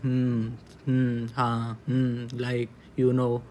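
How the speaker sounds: slow, drawn-out filler hums and words; vocal fillers. Shown as a demonstration. Teacher showing students what vocal fillers sound like, drawn-out hesitation. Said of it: "जैसे कुछ बोलते हैं".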